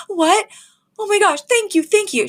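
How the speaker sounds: high, excited thanks; excited gasp. Retold or imitated. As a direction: gush your thanks, high and quick. Fran gets joyful news, voiced.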